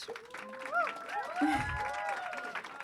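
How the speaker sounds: high-pitched laugh; a burst of high laughter at her own joke. Real, not acted. Host laughing at her own couch joke, high laughter.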